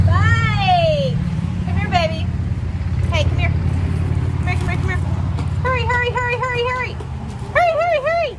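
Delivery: high, loud calling. Real, not acted. Calling the child over.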